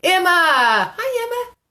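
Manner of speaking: loud call sliding into a high hello; excited high-pitched welcome; delighted greeting. Real, not acted. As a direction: call out her name, then squeal hi. Calling out to arriving viewer.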